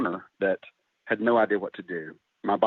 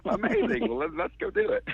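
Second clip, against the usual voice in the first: much higher, bubbly and eager; excited reply. Retold or imitated. Telling [name] he's in, high excited gush. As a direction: gush with sudden enthusiasm. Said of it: "I said".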